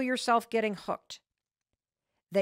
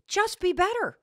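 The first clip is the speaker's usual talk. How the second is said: retold scream; 12 semitones higher, 4 dB louder than usual. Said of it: "Chris did scream at me".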